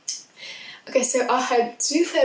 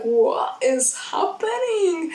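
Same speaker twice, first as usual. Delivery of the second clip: baffled; 8 semitones higher, slower than usual. Retold or imitated. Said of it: "I was like".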